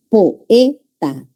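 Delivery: poeta in three beats, po-E-ta; separated hiatus. Shown as a demonstration. Teacher showing students the hiatus, po-E-ta in separate beats. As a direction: break poeta apart, each syllable distinct. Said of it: "Igual en león se separa".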